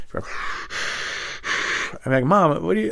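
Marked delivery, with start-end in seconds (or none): 0.3-1.9 heavy breathing. Retold or imitated. Mom stays listening on the extension, heavy breathing. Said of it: "still hear her breathing". 2.3-2.9 high-pitched protest; exasperated son. Retold or imitated. Son catches mom listening on the line, high exasperated protest.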